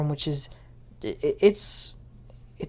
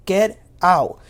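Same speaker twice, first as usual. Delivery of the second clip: warning; 7 dB louder than usual. Retold or imitated. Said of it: "he was warning him".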